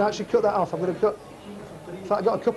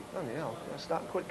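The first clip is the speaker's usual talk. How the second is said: very soft, to yourself; half-swallowed mutter of alarm; muttered aside; 2 semitones lower, 13 dB quieter than usual. Acted. Burger server to himself at the mirror, muttered aside.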